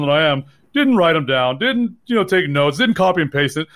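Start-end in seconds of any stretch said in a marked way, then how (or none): none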